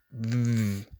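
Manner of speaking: th as in this, voiced; voiced th sound. Shown as a demonstration. Teacher modelling the voiced th. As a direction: hold a buzzing th. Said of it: "that's the sound".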